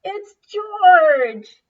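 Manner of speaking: high, loud joyful cry; joyful shout. Acted. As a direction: shout with relief. Rescue arrives.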